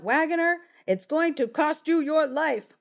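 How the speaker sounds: high, sing-song taunt from the window; taunting call. A narrator's reading. A sparrow taunting the wagoner through the window, high and mocking. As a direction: call it out like a gleeful threat. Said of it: "called out".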